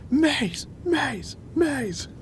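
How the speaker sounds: high, hushed imitation of a crowd chant; small high-pitched mock cheer. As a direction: mimic a distant crowd chanting. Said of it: "the crowd is just cheering".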